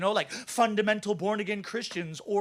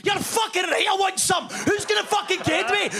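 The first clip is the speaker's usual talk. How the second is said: hammed-up aggressive Scottish accent; fierce Scottish accent; 9 semitones higher than usual. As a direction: rant like a furious Scot spoiling for a fight. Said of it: "The Scottish have the hardest accent on the planet".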